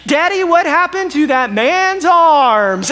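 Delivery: put-on high, loud little girl shouting to her daddy; girl at the pool yelling to her daddy, high and loud. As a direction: imitate a small girl yelling across the pool. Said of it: "at the top of her lungs yells to her daddy".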